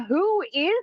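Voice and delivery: a quick mock-shocked cartoon squeak; startled squeak. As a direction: jump up in pitch at your own strange voice. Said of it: "I was like".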